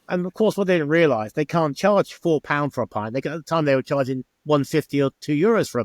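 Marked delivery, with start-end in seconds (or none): none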